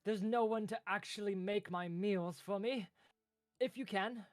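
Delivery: very high, loud delighted little clerk; squeaky high pleading clerk voice. Acted. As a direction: gush with relief and beg. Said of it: "and he kind of says".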